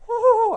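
high, loud joyful whoop; excited whoop. Real, not acted. Preacher whoops over a lineman catching a touchdown. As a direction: cheer it out like a touchdown.

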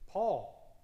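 name him with emphasis; calling a name. Real, not acted. Preacher to congregation, calling out the name firmly.

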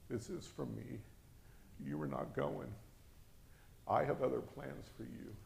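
very soft, measured pronouncement; hushed and deliberate, pausing between statements; solemn divine message. Retold or imitated. The Lord speaking to the preacher, quiet and solemn. The Lord's words, retold.

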